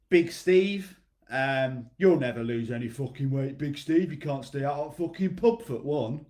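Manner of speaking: a loud, light northern English mate's jeer; northern accent. Retold or imitated. Coach playing a mate mocking Big Steve, northern accent. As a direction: give it a northern pub-mate jab. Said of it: "That's my northern accent".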